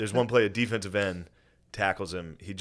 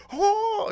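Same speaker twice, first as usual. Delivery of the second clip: very high mock scream; loud, piercing imitated "oh"; 6 dB louder than usual. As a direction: squeal it out like an over-excited player. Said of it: "He's screaming".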